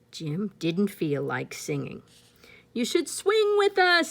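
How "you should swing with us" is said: high, loud monkey voice. Acted. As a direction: hoot it like playful monkeys. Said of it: "said the monkeys".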